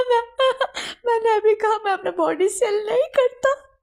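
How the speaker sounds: high, loud sobbing words; crying refusal. Acted. Servant refusing his boss through loud sobs. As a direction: sob out the refusal.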